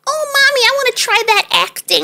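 put-on high, childish voice of a four-year-old; little boy voice. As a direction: voice him as a tiny kid asking sweetly. Mother voicing small son.

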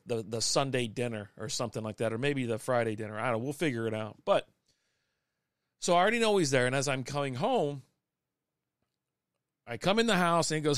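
usual speaking voice

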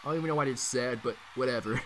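usual speaking voice

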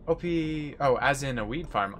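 ordinary talk, nothing marked

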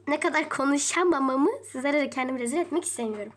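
usual speaking voice

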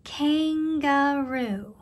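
"Kangaroo" very drawn out, kan-ga-roo; syllable by syllable. Shown as a demonstration. Teacher says listen carefully, "Kangaroo" stretched in three syllables. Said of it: "Let's listen carefully".

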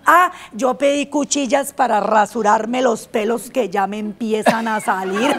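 ordinary talk

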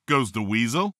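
ordinary talk, nothing marked

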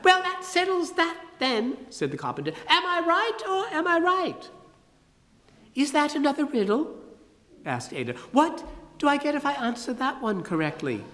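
high, pompous falsetto; grandly mysterious in falsetto; high carpenter voice. Acted. Carpenter declares the riddle unknowable in high falsetto.

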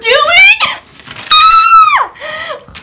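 high squealing laugh of shock; shrieking laugh. Real, not acted. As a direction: burst into a screaming laugh. Overwhelmed reading a surprise letter.

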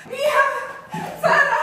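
high, loud frantic call; mother searching the house, frantic shout. Acted. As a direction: shout for her daughter in panic.

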